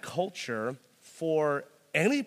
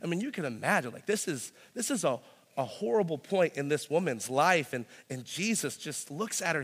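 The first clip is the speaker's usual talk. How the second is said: normal talking voice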